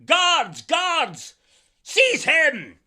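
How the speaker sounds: loud, commanding shout to guards; sultan shouting. Acted. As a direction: bark orders at the soldiers. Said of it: "he shouted to his soldiers".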